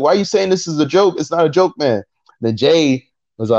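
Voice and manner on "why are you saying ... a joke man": high, mock-exasperated objection; indignant protest. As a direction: push the pitch up in retold protest. Retells an indignant back-and-forth protest.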